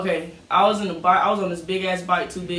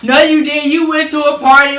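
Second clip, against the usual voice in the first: louder, higher scolding mom; scolding parent; 7 semitones higher, 10 dB louder than usual. Retold or imitated. Mom busting the kids who snuck to a party, loud scolding. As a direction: call the kids out on their lie.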